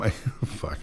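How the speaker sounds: ordinary delivery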